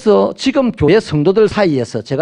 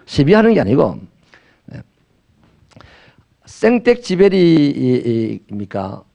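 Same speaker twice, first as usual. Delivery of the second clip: ordinary delivery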